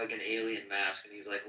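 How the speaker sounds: ordinary talk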